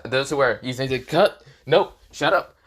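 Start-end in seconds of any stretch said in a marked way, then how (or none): 1.1-2.6 high, loud cut-off; abrupt shout. Acted. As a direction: shout him down before he finishes. Issei cuts Drake off.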